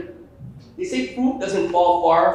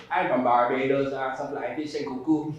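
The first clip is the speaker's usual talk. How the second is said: put-on lower-pitched Bajan accent; Barbadian accent; 4 semitones lower than usual.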